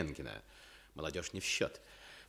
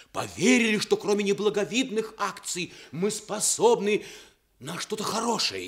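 impassioned; 3 semitones higher, 7 dB louder than usual. Acted. Impassioned speech climax.

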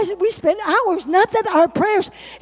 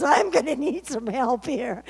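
laughing retelling; 5 semitones lower, 4 dB quieter than usual. Retold or imitated. Laughing retelling son's reply.

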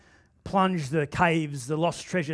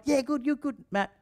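high, breezy brush-off reply; 8 semitones higher, 3 dB quieter than usual. Retold or imitated. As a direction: toss back a quick cheery deflection. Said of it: "Hey, Matt, how you going?".

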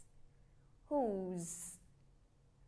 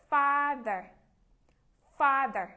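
Father with Fa hit hard and loud; stress on Fa; 7 dB louder, slower than usual. Shown as a demonstration. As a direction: punch the first syllable of Father. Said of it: "Nhấn mạnh chữ phó cho cô xem". (nhấn mạnh chữ Fa trong Father)

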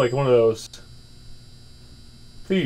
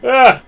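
startled yell; 9 semitones higher, 11 dB louder than usual. Acted. Cashier startled by customer.

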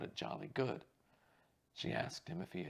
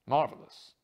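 a big, loud "Marvelous"; loud exclamation; 3 semitones higher, 15 dB louder than usual. Acted. Actor Gardner delighting in the guess, loud and hearty. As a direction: throw it out with gusto.

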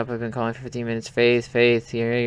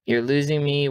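ordinary talk, nothing marked

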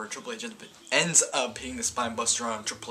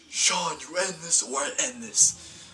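higher, slow, put-on menacing voice; menacing threat; 8 semitones higher, 4 dB louder, slower than usual. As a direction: lay down the ultimatum slowly, like a heel boss. Acted Triple H threat to Shawn.

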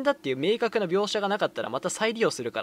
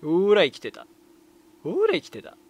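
gloating I-told-you-so; 6 dB louder than usual. Real, not acted. Streamer proved right that the character survived, gloating. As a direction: crow it twice, the repeat pitched up. Streamer's prediction comes true.

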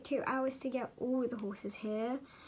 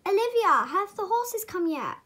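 higher, louder eager call; 5 semitones higher, 6 dB louder than usual. Acted. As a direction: call across to someone with an eager question. Rider calling out to Olivia.

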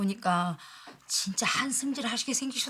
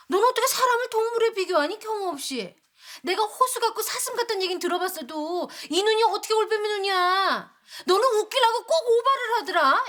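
indignant protest; 7 semitones higher, 3 dB louder than usual. Acted. Neighbor indignantly objects to owl comparison.

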